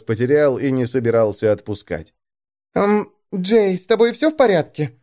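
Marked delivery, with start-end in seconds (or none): 2.7-4.9 lightly voiced wary young woman; cautious, a hint of a girl's voice. A narrator's reading. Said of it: "настороженно спросила Элли".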